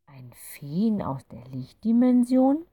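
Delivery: slow, hushed, rising doubt; can't quite believe what she hears; disbelieving. A narrator's reading. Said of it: "Clara etwas ungläubig". (ungläubig, langsam und gehaucht nachgefragt)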